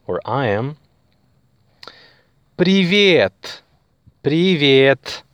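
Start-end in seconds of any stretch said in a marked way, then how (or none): 2.6-3.6 Привет said clearly, falling at the end; Привет, modelled for learners; Russian privet. Shown as a demonstration. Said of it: "Привет means hi". 4.2-5.2 привет said again, clear and even; Russian privet repeated. Shown as a demonstration. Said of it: "Привет means hi".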